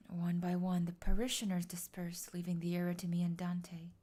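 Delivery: low, hushed whispered narration. Acted. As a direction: whisper it like in church.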